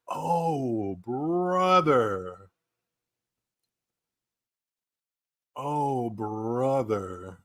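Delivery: long, stretched-out groan of words; slow, sinking "oh, brother"; drawn-out dismay. Real, not acted. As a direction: draw each word out softly in dismay. Dismay at the pictures.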